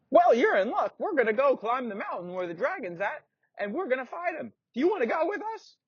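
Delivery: put-on a young boy invites the blacksmith along; little kid voice. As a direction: pitch it way up like a small boy. Timothy as little kid speaks.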